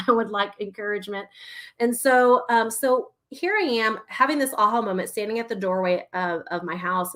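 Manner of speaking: normal talking voice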